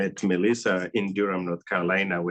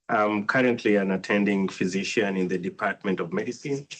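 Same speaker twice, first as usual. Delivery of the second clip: usual speaking voice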